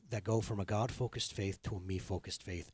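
ordinary talk, nothing marked

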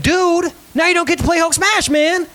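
high-pitched dad whine, acted out; disappointed whine. Dad finds son's room not clean, disappointed high whine. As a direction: protest in a high, let-down voice. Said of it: "I'm disappointed 'cause I wanted to play".

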